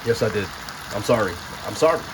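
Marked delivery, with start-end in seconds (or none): 1.7-2.0 higher-pitched playful apology; mock apology. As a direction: repeat a mock sorry in a raised pitch. Repeats mock apology to Italy.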